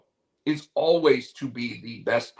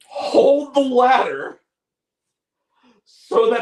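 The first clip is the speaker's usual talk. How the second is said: announced loud; 7 semitones higher, 7 dB louder, slower than usual. Real, not acted. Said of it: "topic announced again".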